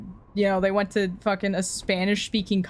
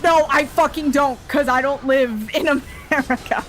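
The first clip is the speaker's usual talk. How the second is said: indignant comeback; 4 semitones higher, 4 dB louder than usual. Real, not acted. Indignant retort to the imagined American.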